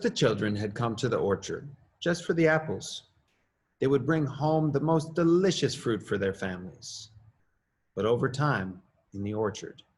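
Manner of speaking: plain reading voice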